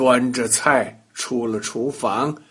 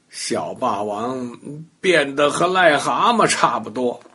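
ordinary delivery

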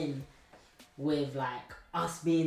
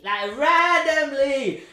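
much higher, louder, slow exclamation of disbelief; incredulous exclaim; 11 semitones higher, 13 dB louder, much slower than usual. Real, not acted. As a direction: stretch it out in loud disbelief. Disbelief at her random remark.

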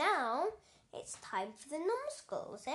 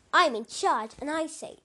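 loud bossy proclamation; bossy shout; 9 dB louder than usual. Acted. Brainy loudly declares he is in charge to the eye numbskull. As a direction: assert you're the boss.

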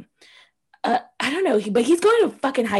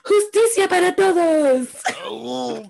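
slow, mock American-accented Spanish, higher; 5 semitones higher, much slower than usual. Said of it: "The way she said that is the way".

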